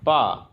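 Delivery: पा dropping sharply from high; पा meaning father, falling tone; falling fourth tone. Shown as a demonstration. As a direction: cut पा down hard. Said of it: "fourth tone के साथ".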